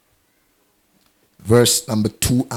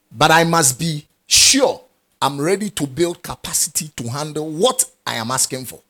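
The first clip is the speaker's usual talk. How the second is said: ordinary talk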